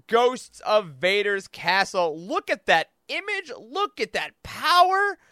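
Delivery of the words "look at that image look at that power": slow, high, awestruck; awed. Real, not acted. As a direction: draw it out in awe. Hyping the cover art.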